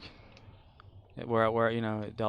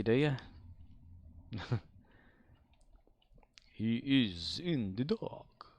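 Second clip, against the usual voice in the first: usual speaking voice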